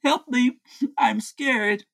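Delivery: high-pitched mock distress; feigned cry for help. [name] faking fear while secretly eating, mock cry for help. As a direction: put on a brief frightened plea. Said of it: "He is screaming help, and I'm scared".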